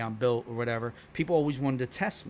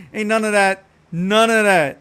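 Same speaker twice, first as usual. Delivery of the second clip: loud, higher-pitched protest; emphatic denial; 8 semitones higher, 12 dB louder than usual. Real, not acted. Streamer rejecting a wrong accent guess, loud emphatic denial. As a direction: shut the guess down loudly.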